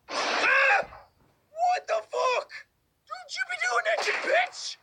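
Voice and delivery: high, panicked shriek; scared scream. Real, not acted. Gamer screaming at the animatronic, terrified. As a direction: shriek in real fright.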